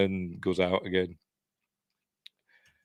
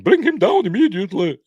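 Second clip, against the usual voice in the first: high, loud imperious put-on accent; hammed-up shrieked order in a silly accent; Lee Remick put-on voice; 12 semitones higher, 9 dB louder than usual. Repeating Kathy's order for the listener, high loud mock accent. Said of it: "I don't know why I did that voice".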